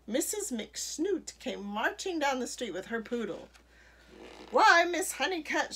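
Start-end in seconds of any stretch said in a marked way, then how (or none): 4.5-5.7 loud, high indignant shout; big storybook shout. A narrator's reading. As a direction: shout it at the neighbour, pitched up. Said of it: "shouted Missus McSnoot".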